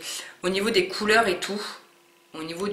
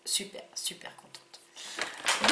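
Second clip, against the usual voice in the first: whisper; 8 dB quieter, much slower than usual. Real, not acted. Slow repeated whisper.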